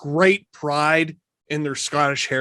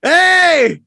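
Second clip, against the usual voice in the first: high, loud delighted shout; surprised shriek; 8 dB louder than usual. Real, not acted. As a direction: cry out in thrilled surprise. Surprise message starts playing.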